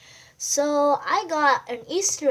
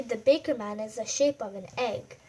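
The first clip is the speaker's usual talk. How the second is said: ordinary delivery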